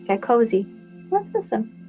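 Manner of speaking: ordinary delivery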